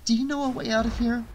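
fast, high-pitched question; hurried plea, kept at normal volume; urgent. A narrator's reading. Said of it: "she said urgently".

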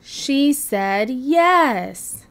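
slow, loud, happy; happy mishearing. Acted. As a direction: cheer the misheard yes. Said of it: "said Digger".

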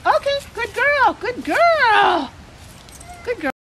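high dog praise; squeaky praising a dog. Real, not acted. Praising dog after trick.

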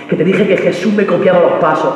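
ordinary talk

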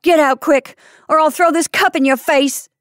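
loud, high-pitched angry threat; fierce threat. A narrator's reading. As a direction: threaten fiercely to send them running. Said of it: "she said fiercely".

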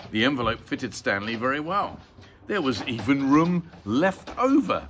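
ordinary delivery, nothing marked

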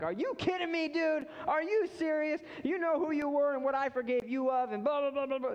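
hammed-up disbelief at a stubborn friend; exasperated rebuke. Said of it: "be like".

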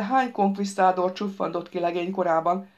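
plain reading voice

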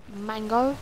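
ordinary talk, nothing marked

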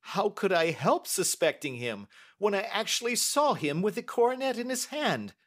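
high, agitated banker's voice; indignant banker. Acted. Uncle insisting to his niece on what he saw, indignant. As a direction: protest with high, emphatic indignation.

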